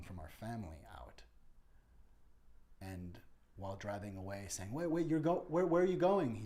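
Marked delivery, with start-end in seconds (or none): 4.7-6.3 high, loud, frantic calling after a car; panicked calling out. Retold or imitated. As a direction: call out in panic as they drive off. Family member taken, calling out.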